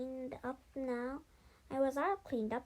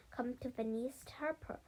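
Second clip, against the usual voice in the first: ordinary delivery, nothing marked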